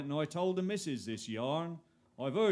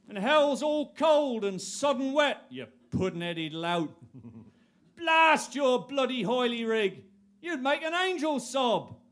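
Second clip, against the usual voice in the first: high, loud ranting devil; angry devil; 11 semitones higher, 6 dB louder than usual. Acted. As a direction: rant like a furious devil. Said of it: "the devil himself".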